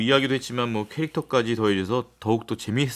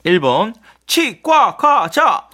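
high and very slow, louder; drawn-out high silly answer; 7 semitones higher, 6 dB louder, much slower than usual. Acted. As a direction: stretch 치과과자 out high and loud like a joke. Quiz answer read out comically.

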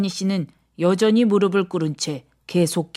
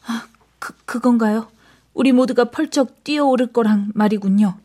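faint gasped start, then higher voice; frightened; 4 semitones higher than usual. A narrator's reading. Christine realises they will all leap, frightened. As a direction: stammer in dread as realisation lands.

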